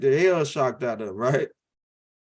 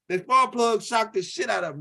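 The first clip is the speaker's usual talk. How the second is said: usual speaking voice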